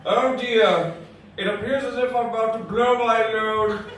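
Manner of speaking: lightly voiced stiff formal gentleman, laughing through it; prim, proper commentary on a sex scene; mock Hitchcock. Comic narrating a seduction in prim Hitchcock voice. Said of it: "thin Alfred Hitchcock".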